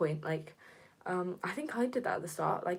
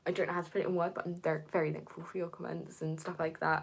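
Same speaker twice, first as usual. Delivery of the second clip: usual speaking voice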